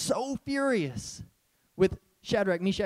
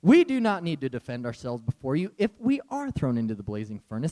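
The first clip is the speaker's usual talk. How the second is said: bored reply; 7 semitones lower than usual. Retold or imitated. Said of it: "they're probably rolling their eyes".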